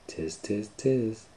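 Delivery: lightly voiced teasing monkey tsk; monkey chatter. Monkeys mocking the peddler back, soft teasing chatter. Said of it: "and they said".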